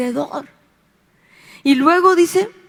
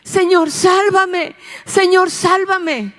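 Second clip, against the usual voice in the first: gritando; 6 semitones higher, slower than usual. Acted. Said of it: "Pero empezó a gritar".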